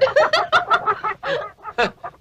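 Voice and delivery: long high laugh. Acted. Woman laughs after joking about a star, laughing.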